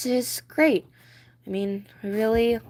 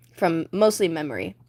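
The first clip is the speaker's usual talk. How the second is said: normal talking voice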